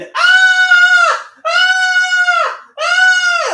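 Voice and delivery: very high, loud mock screams; imitating screaming ladies. Vlogger imitating ladies screaming at him, high mock shrieks. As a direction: shriek like fans. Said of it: "The ladies are screaming".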